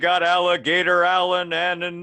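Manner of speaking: booming ring announcer, stretched out; wrestling announcer. Pretend. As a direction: hype the fighters like a ring announcer. Mock wrestling ring announcer intro.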